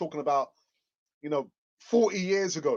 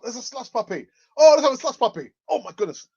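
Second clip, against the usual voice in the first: excited; 7 semitones higher, 10 dB louder than usual. Retold or imitated. Retold childhood excitement at shop.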